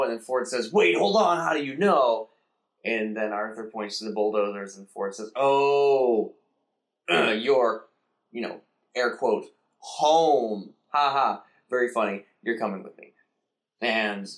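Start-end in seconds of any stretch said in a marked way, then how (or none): 0.7-2.3 high, loud startled question; mock surprise; surprised Ford. Ford surprised, acted quote. 5.4-13.1 louder mocking drawl; air-quote sneer at the word home; sarcastic put-down; sarcastic Ford. Ford's sarcastic acted quote.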